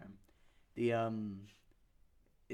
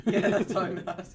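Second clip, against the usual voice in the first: laughing; 8 semitones higher, 14 dB louder than usual. Real, not acted. Laughing at joke.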